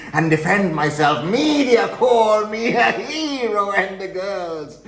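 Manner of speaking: building to high, loud glee; elated boasting. Acted. Killer boasting the media called him hero, mounting elation.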